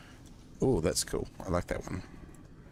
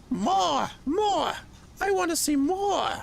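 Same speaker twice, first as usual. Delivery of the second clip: shrill frenzied shout, much higher; manic shouting; 4 dB louder, slower than usual. Acted. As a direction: scream it out like a crazed villain. Villain demanding more in game.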